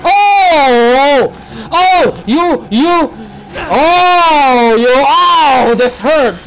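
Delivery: long, high, loud howls of shock; startled screaming. Real, not acted. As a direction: yell at a sudden attack. Monster attacks the player.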